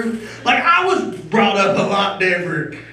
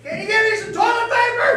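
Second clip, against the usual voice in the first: mock wife voice; 13 semitones higher than usual. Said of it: "and then you hear".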